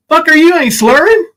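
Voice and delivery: loud, high-pitched retort; indignant challenge. Real, not acted. Challenges the claimed slurring.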